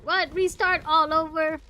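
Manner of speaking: high, loud disbelieving question; indignant outcry. Real, not acted. Player told to restart, loud disbelief. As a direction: yell it in disbelief.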